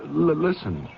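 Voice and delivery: stammering, at a loss for words; nervous, broken-off start; halting stammer. Acted. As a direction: stumble over the start, dreading what comes next. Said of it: "You have an odd tone in your voice".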